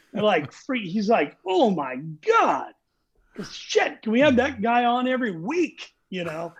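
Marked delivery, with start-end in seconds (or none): 1.5-5.9 amazed excitement. Retold or imitated. Director amazed by singer.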